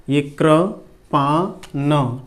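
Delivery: Kirpan drawn out very slowly; slow syllable by syllable Kirpan; slow dictation. Shown as a demonstration. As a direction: stretch Kirpan as you write it. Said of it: "ठीक है, कृपान".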